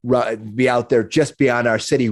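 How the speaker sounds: ordinary talk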